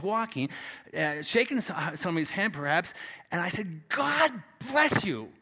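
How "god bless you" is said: GOD high and loud, then a short pause; emphatic blessing. Retold or imitated. Preacher blessing someone uptown, with emphasis. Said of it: "But I say it with emphasis".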